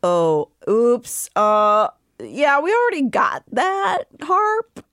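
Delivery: acted awkward confession, rising high and slow at the end; mock guilty, drawn-out oops; sheepish admission. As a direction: own up reluctantly, stretching it out. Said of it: "Cassian and Nesta are like".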